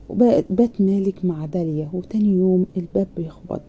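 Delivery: ordinary delivery, nothing marked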